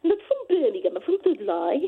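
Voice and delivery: lightly voiced Dudley accent correcting her. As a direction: hairdresser setting her straight, in a Dudley accent. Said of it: "and I said to him".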